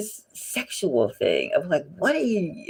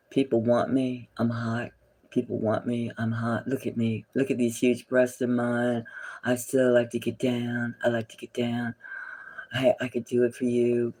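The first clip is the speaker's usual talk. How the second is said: mock sultry star; 6 semitones lower, 5 dB quieter than usual. Voicing the star mockingly.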